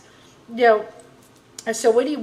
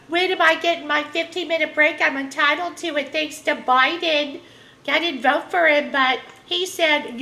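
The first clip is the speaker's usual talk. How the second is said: mocking Karen in a higher voice; 6 semitones higher, 3 dB louder than usual. Mocking a Karen who wants her break, higher mock voice. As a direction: pitch up and lampoon her entitlement. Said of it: "Oh, you, you Karens".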